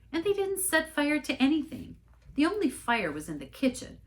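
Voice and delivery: lightly voiced mom correcting the rumors; firm mother. Mother firmly corrects minister.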